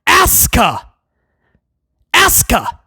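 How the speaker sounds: lightly mocking commentator imitation; mocking Barrett's call. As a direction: mimic the commentator's accent calling her name. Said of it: "listening to Wade Barrett call".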